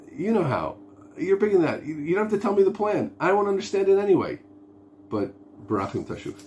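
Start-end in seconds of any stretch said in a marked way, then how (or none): 0.1-4.4 high-pitched, trusting appeal; pleading with God. Acted. Believer asks Hashem to work it out, pleading. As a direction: hand it all over in a high, imploring voice. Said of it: "turning to Hashem and saying".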